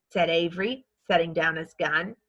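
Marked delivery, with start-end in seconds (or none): none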